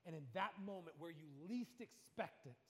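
usual speaking voice

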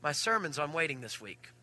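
low, very soft realization; hushed, sinking private thought; retold inner thought. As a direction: say it low and small, as a thought to yourself. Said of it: "in my mind went".

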